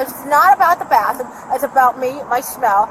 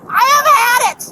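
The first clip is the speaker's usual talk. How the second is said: angry outburst; 9 semitones higher, 4 dB louder than usual. Real, not acted. Furious at officer over stolen card.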